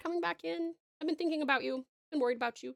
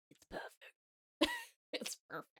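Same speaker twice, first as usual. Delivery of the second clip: whispered; 11 dB quieter than usual. Real, not acted. Admiring her own drawing.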